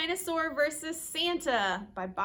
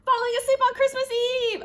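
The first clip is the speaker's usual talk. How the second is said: loud, high, ringing proclamation; big storytime reveal; dramatic announcement; 11 semitones higher, 9 dB louder than usual. A narrator's reading. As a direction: announce the ultimate challenge. Announces the hardest challenge.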